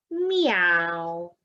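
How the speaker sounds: drawn-out farewell meow; cat saying goodbye with a meow. Acted.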